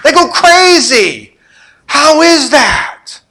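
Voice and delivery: high, slow, amazed voice of the returning disciples; wide-eyed disciples, high and loud; excited disciples. Retold or imitated. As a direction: marvel out loud, almost shouting. Disciples' excited report, demons shriek.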